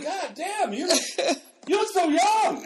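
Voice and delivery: loud, high-pitched retold astonishment; acted amazement. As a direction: gush in exaggerated disbelief at an old classmate. Acting classmates amazed at his looks.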